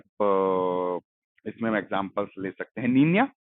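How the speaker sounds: normal talking voice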